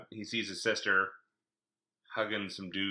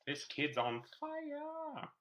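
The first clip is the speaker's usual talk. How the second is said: higher, slow, drawn-out alarm; mock panicked Yuri; 12 semitones higher, much slower than usual. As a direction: gasp it out like an intimidated skater. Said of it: "He's like".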